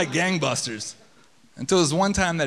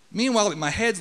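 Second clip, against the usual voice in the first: ordinary delivery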